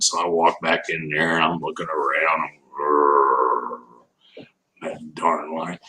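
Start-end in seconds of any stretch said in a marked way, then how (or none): 2.8-5.8 low growl, then a mutter at the lion; mock angry growl. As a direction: grumble it under your breath, lower. Mad after the lion leaves.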